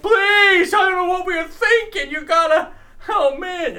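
high, loud begging voice; pleading Kathleen Kennedy. Retold or imitated. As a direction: beg desperately in a squeaky voice. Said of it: "because she's like".

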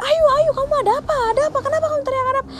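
high, rapid-fire worried questioning; urgent, high-pitched retold questions; acted alarm. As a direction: imitate an alarmed neighbour firing questions. Neighbour reacts to the screaming, acted.

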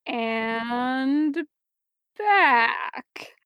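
long held words, with a pause between; drawn-out narration. A narrator's reading. Narrator showing heads tipping further back, drawn out. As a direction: stretch each word out slowly.

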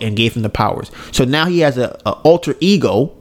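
ordinary talk, nothing marked

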